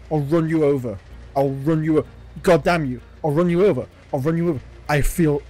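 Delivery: loud, agitated repeated warning; shouted threat. Real, not acted. Driver threatening to run the attacker over, shouting. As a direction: shout it urgently at someone in the road.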